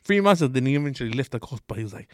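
ordinary talk, nothing marked

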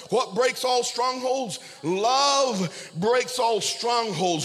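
"Love breaks all strongholds" drawn out very slowly, each word leaned on; preached slowly. Acted. Pastor drives the key point home to the church, slow and weighty. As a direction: preach "Love" and stretch the whole line.

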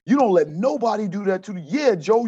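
high, loud retold pep talk; pumping him up. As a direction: hype up a player like his coach. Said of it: "I just pumped him up".

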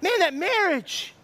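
high, drawn-out admiration; admiring onlooker. Acted. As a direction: gush over a couple as an impressed bystander.

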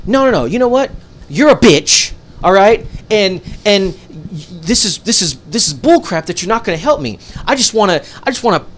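high, loud mock outrage; imitated angry customer. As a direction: rant like a customer who has had enough. Imitates furious customer cursing at him.

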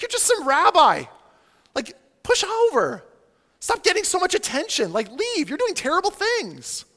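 high-pitched mock outrage; indignant challengers. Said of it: "they're asking the question of him".

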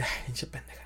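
whispered insult, no voice; under-the-breath insult. Retold or imitated. Muttering to himself about a girl who asked for a photo, in a whisper. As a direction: hiss it under your breath. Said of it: "Y yo así de".

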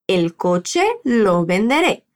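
"El coche lo venderé" slow, with a question-like rise; slow model phrase. Shown as a demonstration. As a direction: model it slowly. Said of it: "Te toca repetir".

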